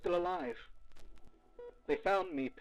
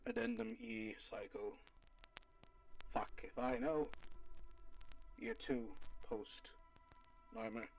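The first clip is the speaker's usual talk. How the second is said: softer, drained, barely holding on; exhausted; 4 semitones lower, 10 dB quieter than usual. Acted. Sleepless archivist logs a new entry after days of chess, exhausted. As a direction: sound worn out from no sleep.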